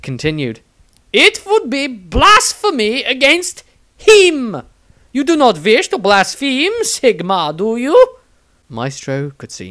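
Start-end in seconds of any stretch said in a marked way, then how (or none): 1.1-8.2 high, loud, syrupy nun voice; a much higher, louder fervent sister; zealous nun. A narrator's reading. Nun Angelique sweetly insists to the wizard, high and loud.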